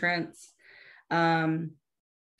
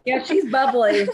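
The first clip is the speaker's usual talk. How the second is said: laughing through it, louder and higher; teasing laugh while talking; 6 semitones higher, 8 dB louder than usual. Real, not acted. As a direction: giggle the words out at your friend.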